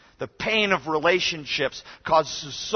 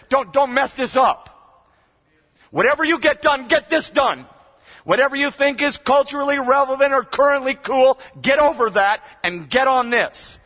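urgent shout; 7 semitones higher, 4 dB louder than usual. Real, not acted. Preacher urging students in chapel, loud earnest plea. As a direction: drive it home, almost shouting. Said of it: "I just know it means this".

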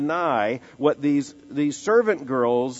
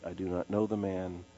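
much softer, lower, dropped to a hush; quiet denial; 5 semitones lower, 8 dB quieter than usual. Acted. Pastor quietly repeats Peter's final denial, hushed and low. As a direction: let the denial sink to a hush.

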